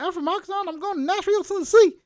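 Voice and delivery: put-on fast, high country-girl accent, loud on the last word; hammed-up country accent rising to a loud finish. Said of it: "that shorty country accent".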